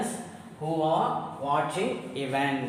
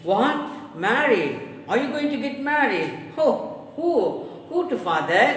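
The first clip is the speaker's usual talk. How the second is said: high, loud disbelieving cries; shocked; 8 semitones higher, 4 dB louder than usual. Acted. Daughter shocked at father's marriage news, high and loud. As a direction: gasp in disbelief.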